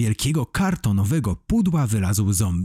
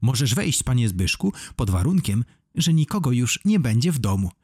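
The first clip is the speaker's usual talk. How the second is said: ordinary delivery, nothing marked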